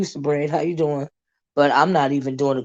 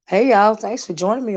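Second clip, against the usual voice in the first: excited greeting; 6 semitones higher than usual. Real, not acted. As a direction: call out a big welcome to everyone. Opening greeting to live chat.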